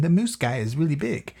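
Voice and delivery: ordinary delivery, nothing marked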